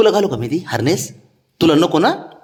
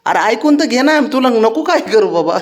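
much higher, pleading sing-song; high pleading pet talk; 9 semitones higher than usual. Real, not acted. Owner pleading with the sulking dog, high sing-song pet talk.

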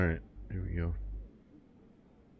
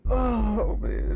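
laughing; 12 semitones higher, 5 dB louder than usual. Real, not acted. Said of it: "I can't stop laughing".

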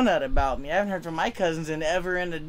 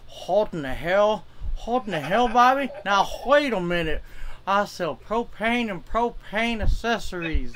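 slow, twangy Texan cartoon dad scolding his son; Texas propane dad impression. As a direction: drawl it out like a stern Texas propane salesman dad. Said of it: "that's as far as I can go with that one".

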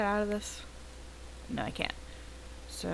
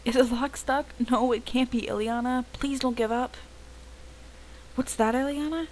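higher, urgent pleas from inside the trunk; trapped and pleading; 6 semitones higher than usual. Acted. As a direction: beg Ileana to get you out. Trapped Madison begs for help.

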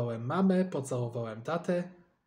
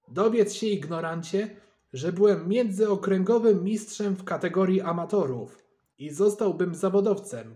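higher, louder offended outburst; indignant boast; 5 semitones higher, 6 dB louder than usual. A narrator's reading. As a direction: fire it back, stung pride. Bledur angrily asserts his title.

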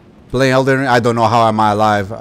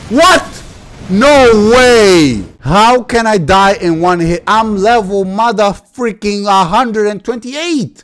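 high, loud outcry; real outrage at a one-hit death; shocked disbelief; 7 semitones higher, 4 dB louder, slower than usual. Real, not acted. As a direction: yell it in disbelief.

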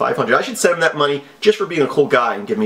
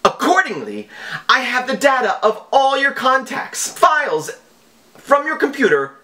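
high, theatrical villain read of the email; mock scammer voice; 9 semitones higher than usual. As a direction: read the scam letter like a hammy hacker. Reading scam email in mock voice.

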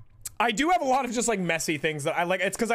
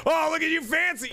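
mocking friends; 7 semitones higher than usual. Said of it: "They'll be like".

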